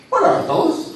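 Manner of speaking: showman's excited question. Acted. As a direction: pump up the crowd with a high question. Hypnotist hyping the volunteer.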